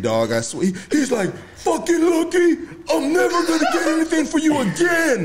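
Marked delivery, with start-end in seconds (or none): 1.6-5.2 high-pitched mock furious outburst; hammed-up high tantrum; losing his lid. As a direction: imitate an angry little man blowing up. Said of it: "this fool lost his fucking".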